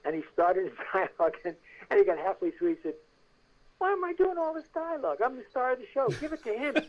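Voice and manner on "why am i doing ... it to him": high-pitched petulant whine, imitated; acted diva grumbling over lines; complaining star. Star of the show halfway through scene, petulant.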